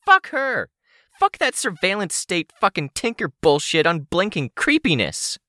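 high-pitched, furious villainess rant; high, angry character voice; pissed off. A narrator's reading. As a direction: curse her out at a high pitch. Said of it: "I think she's pissed".

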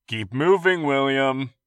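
loud, high call over shoulder. Acted. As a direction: shout it on the run. Gamble calling out while running.

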